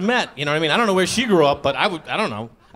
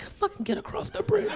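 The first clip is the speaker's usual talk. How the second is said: hissed urging; 12 semitones higher, 9 dB quieter than usual. Retold or imitated. Urging son under breath.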